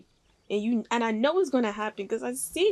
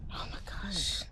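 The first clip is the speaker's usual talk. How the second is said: whisper; 8 dB quieter than usual. Real, not acted. Reacting to tense scene.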